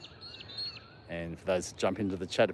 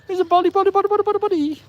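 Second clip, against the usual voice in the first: squeaky dog talk; 9 dB louder, faster than usual. Real, not acted. Owner cooing at his Staffy, very high squeaky voice. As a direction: coo at the dog in a tiny high voice.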